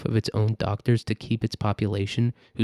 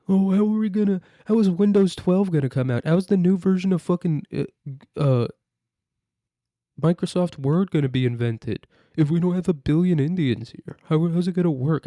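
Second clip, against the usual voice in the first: high, louder caricature of a worrier; exaggerated, high-pitched fake dismay; mock panic; 7 semitones higher, 4 dB louder than usual. Mock panic of tech demanders.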